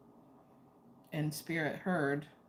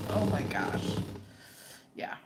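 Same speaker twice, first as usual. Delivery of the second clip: ordinary delivery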